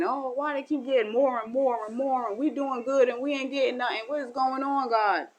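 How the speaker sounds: aggrieved plea to God; whiny complaint. Retold or imitated. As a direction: moan at God about unfairness.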